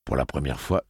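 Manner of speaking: ordinary talk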